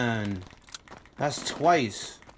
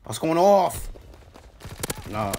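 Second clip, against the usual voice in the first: ordinary delivery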